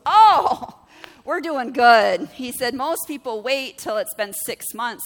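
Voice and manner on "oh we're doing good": a much louder, higher "Oh", then slow relief; mortgage agent reassuring the caller, loudly relieved; loud relieved exclaim. Retold or imitated.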